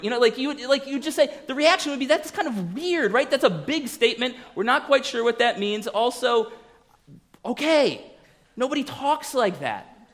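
mock bewilderment, high voice; weirded-out bystander. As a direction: voice a baffled onlooker, pitched well up. Acted bystanders' reaction to strange claim.

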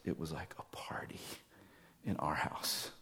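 hushed whisper, full of wonder; whispered quote. Retold or imitated. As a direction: breathe it like a secret joy. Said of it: "she says".

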